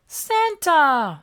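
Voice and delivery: put-on high, loud childlike cry; Elmo excited shout. A narrator's reading. Elmo spotting the sleigh, high and loud. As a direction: call out at the sky. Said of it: "said Elmo".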